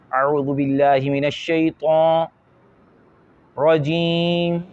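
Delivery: slow, drawn-out recitation pushed through the nose; mock faulty nasal tajweed; nasal recitation imitation. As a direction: imitate a child reciting with sound going into the nose. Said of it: "ناک میں آوازیں جا رہی ہیں".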